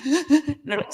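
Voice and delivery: ordinary delivery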